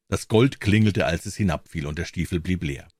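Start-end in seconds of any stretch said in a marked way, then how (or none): none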